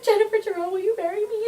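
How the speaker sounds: a sweet high mock proposal; voicing Will. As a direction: ask her to marry you, pitched well up. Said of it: "he's like".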